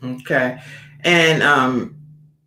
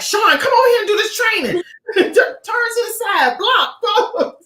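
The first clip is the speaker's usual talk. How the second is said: high, laughing call to her friend; urgent, giggly "come do this training"; excited call. Retold or imitated. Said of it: "I was like".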